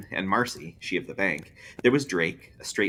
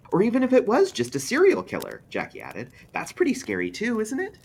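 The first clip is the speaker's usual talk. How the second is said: Jackie's eager voice; 14 semitones higher, 4 dB louder than usual. A narrator's reading.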